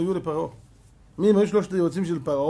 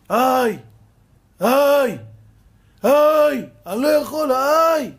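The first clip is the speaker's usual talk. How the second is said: loud, high pained cries of "איי"; mock cries of pain; 7 semitones higher, 9 dB louder, much slower than usual. Sick man in hospital crying out, loud pained cries. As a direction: cry out to the heavens in pain. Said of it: "צועק לשמיים".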